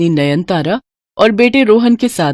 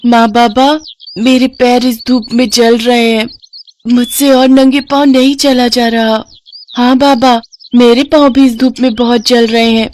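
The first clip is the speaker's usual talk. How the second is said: put-on high, pleading little girl; small child whining about hot feet; 5 semitones higher than usual. Little daughters complain of burning feet.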